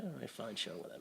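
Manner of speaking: very soft, dismissive mumble; mock indifferent mumble. Scoffing at the movie's dramatic moment, mumbled dismissively. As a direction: shrug it off under your breath, half laughing. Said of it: "I was like".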